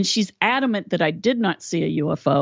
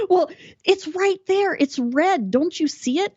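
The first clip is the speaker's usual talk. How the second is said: much higher, insistent mom voice; high mock mother, can't believe you miss it; imitating exasperated mom; 12 semitones higher than usual. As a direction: do your mother losing patience. Mother exasperated about the bird.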